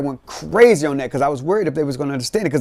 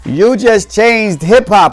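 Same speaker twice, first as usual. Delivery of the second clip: quoting praise; 5 semitones higher, 5 dB louder, much slower than usual. Retold or imitated.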